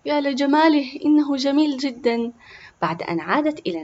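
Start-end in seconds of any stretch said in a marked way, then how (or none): 0.0-2.3 higher voice, lightly moved to tears; tearful wonder. A narrator's reading. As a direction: marvel tenderly at a newborn son. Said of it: "سقطت الدموع من عينيها قائلة". (تأثّر خفيف حتى الدموع)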